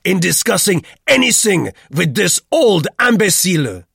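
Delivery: high, contemptuous and loud; loud declaration. A narrator's reading. Roux insults Duclos to Vogel, loudly. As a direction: fling the insult at full volume. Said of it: "declared Roux loudly".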